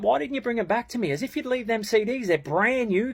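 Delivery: high-pitched outraged protest; retold exasperated rant; indignant scolding. Acted quarrel over lent CDs.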